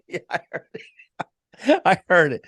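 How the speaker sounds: high-pitched laugh through the words; can't get the words out for laughing. Real, not acted. Laughing at the wife-beating joke, cracking up.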